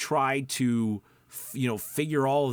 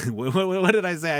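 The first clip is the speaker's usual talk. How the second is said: laughing; 6 semitones higher, 6 dB louder than usual. Real, not acted. Loses his train, laughing.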